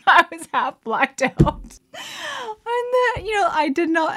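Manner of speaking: high, loud words breaking into laughter; laughing while talking. Real, not acted. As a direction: struggle to talk through real laughter. Laughing at her own accident.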